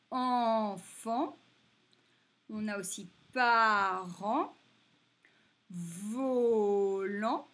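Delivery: put-on word list read out loud for children; teacher's repeat list. Shown as a demonstration. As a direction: call out each word clearly for the class. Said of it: "Alors, je répète".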